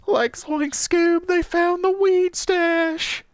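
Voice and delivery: very high, very slow stoner Shaggy voice; terrible Shaggy impression. Retelling the joke with a friend, a terrible Shaggy voice. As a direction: drag it out high like a bad Shaggy. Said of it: "doing a terrible Shaggy impression".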